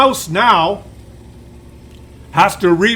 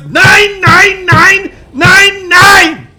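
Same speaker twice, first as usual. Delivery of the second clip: loud, high desperate screaming; mouse screaming nein; 9 semitones higher, 8 dB louder, much slower than usual. Pretend. The mouse screams under a barrage of fire. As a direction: scream it like a cornered tank. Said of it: "And the mouse says".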